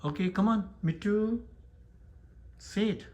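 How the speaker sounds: high-pitched pet-training call; coaxing a pet. Real, not acted. Prompting pet to talk.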